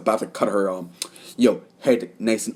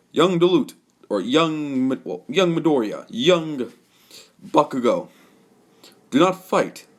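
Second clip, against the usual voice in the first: commanding call; 6 semitones higher than usual. Acted. All Might stops the fight.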